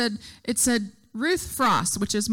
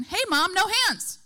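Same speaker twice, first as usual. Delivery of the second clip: higher imitated kid voice; your own child; 7 semitones higher than usual. One child calling mom on the playground, higher kid voice. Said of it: "till it's your baby".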